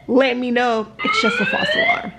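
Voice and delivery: high sarcastic sing-song; mock sing-song. To viewers, mocking security in a high sing-song. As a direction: mimic the guard's cheery news, mocking.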